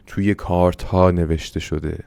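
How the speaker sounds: ordinary talk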